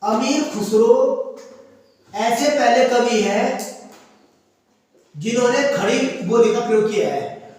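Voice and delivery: slow dictation, phrase by phrase; measured, halting note-giving. Shown as a demonstration. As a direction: dictate slowly with pauses for students to write. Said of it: "वहीं पर लिखो star करके".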